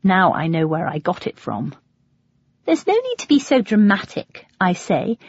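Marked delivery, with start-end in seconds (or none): 2.6-4.5 high, mock-motherly scolding; mum's voice. A narrator's reading. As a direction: play her mum chiding. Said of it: "in a voice that sounds exactly like Mum's".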